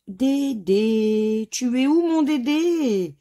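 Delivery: mock search call, long and high; playful calling out. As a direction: call for a lost Dédé, stretching the vowels. Playfully calls out for missing letters.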